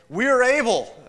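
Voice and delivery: very high, loud eager claim; cocky boast. Acted. James and John answering Jesus, a very high loud boast. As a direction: blurt it out, overconfident.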